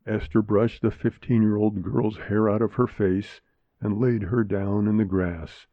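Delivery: plain reading voice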